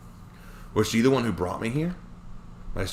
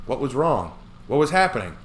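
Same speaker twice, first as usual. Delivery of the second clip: anxious; 6 semitones higher, 4 dB louder than usual. Acted. Narrator panicking inside.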